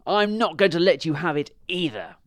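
loud, high refusal; stubborn storybook dog refusing to share; selfish dog. A narrator's reading. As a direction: refuse loudly, out of spite. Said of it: "said the selfish dog".

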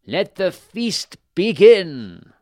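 loud, high, drawn-out royal announcement; booming call to start the feast; king's proclamation. A narrator's reading. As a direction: proclaim it slowly to the whole hall. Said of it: "He said".

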